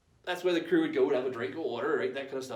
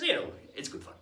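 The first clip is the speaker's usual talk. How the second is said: usual speaking voice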